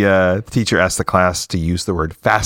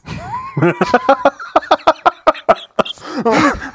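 real high burst of laughter; big laugh. Real, not acted. Teller laughing at his own punchline, real laugh. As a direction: crack up at the joke.

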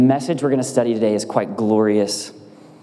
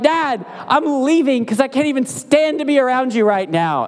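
put-on huffy toddler; 13 semitones higher than usual. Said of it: "And she's like".

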